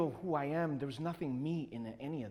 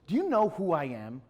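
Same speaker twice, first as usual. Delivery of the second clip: louder, puffed-up retold challenge; self-important challenge; 7 dB louder than usual. Big man confronting the artist, self-important challenge. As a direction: demand recognition, hitting it hard.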